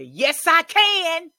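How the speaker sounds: slow high shouted affirmation; defiant shout. Shown as a demonstration. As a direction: hurl it at the lying spirits. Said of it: "look back into his ... faces and say".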